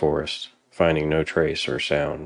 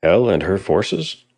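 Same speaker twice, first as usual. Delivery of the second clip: slow, raised, anxious question; worried; 4 semitones higher, 5 dB louder than usual. A narrator's reading. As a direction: ask it with worry. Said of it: "Ivar asked worriedly".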